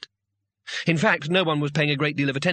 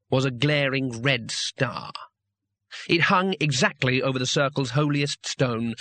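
usual speaking voice

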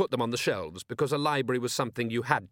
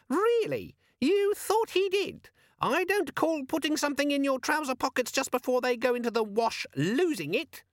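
very high squeaky scoff; very high, squeaky sarcastic demon. A narrator's reading. Organizer demon mocking Carrot, squeaky and sarcastic. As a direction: sneer like an offended gadget.

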